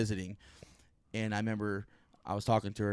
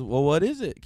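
lightly voiced grandma, raised pitch; higher, louder curious question; grandma's voice; 6 semitones higher, 7 dB louder than usual. Grandma asks about the video, lightly imitated high voice. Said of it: "And she goes".

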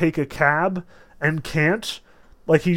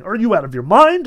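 high, raised exclamation; indignant storybook yell, moderately loud; narrated shout; 6 semitones higher, 6 dB louder, faster than usual. A narrator's reading. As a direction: call it out in outraged disbelief. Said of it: "I run after him shouting".

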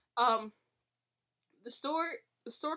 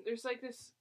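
usual speaking voice

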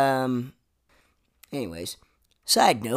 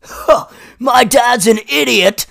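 mocking old people; 8 semitones higher, 8 dB louder, slower than usual. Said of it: "old people be like".

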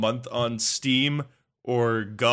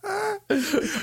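laughing; 3 dB quieter than usual. Real, not acted. Laughing about plugging game.

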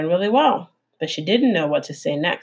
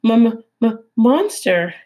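slow, higher stuttering fear; frightened stammer; 5 semitones higher, 4 dB louder, slower than usual. Acted. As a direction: stammer it out, scared of the monster. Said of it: "the opossum gasped".

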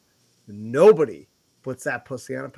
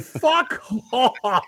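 mock-outraged outburst, higher pitch; irritated retort; 6 semitones higher than usual. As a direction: snap back at a pedant in exasperation. Retold irritated retort to pedant.

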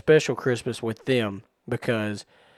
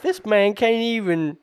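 put-on scornful kid voice; 10 semitones higher, 6 dB louder than usual. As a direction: scoff like a big brother. Older brother voiced mocking sibling.

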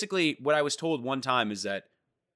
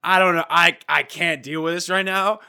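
mock flustered Boris; 5 semitones higher, 8 dB louder than usual. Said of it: "he was literally just like".

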